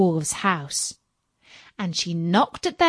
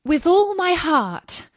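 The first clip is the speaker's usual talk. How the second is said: bright, higher, eager reply; 8 semitones higher, 4 dB louder than usual. A narrator's reading. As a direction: say it gladly and higher.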